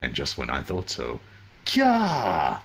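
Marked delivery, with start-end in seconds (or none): 1.7-2.6 high, small mock shriek; mock girlish scream. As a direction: squeal like a startled girl. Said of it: "I'm not screaming that out".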